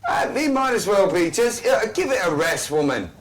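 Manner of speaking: high comic voice. Acted.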